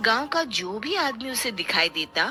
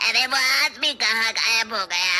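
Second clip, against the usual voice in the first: menacing witch; 2 semitones higher, slower than usual. Acted. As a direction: threaten like a hunting witch. Witch hunts hiding man. (धमकाती चुड़ैल की आवाज़)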